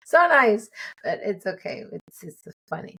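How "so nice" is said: louder, higher laughing mock coach; hammed-up coach impression with a laugh; imitating coach. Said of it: "He's always talking with a little accent".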